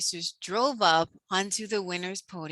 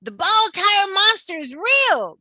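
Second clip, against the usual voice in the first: high, loud frightened insistence; scared, loud insistence; shivering; 11 semitones higher, 7 dB louder than usual. A narrator's reading.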